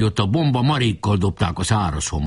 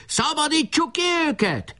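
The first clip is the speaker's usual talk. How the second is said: very high-pitched battle yell; rally the troop with a high cry; howl. A narrator's reading. Enemy chief urging his troop to free prisoners, howling. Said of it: "üvöltött Ács Feri".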